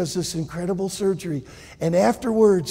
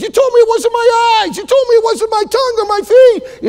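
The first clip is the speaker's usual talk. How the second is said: frantic protest; 9 dB louder than usual. Acted.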